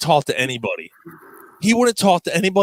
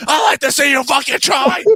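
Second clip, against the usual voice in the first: high, loud yelled dare; furious mock shout; 13 semitones higher, 4 dB louder than usual. Coworker daring the prank caller before slamming the phone, furious shout. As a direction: yell it like you'll slam the phone.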